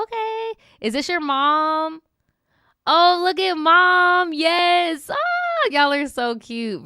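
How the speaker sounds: high excited squeal over a cute photo; gushing. Acted. To her viewer, looking at her mom's photo: high gushing delight. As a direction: gush like you just saw something adorable.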